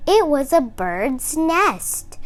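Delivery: plain reading voice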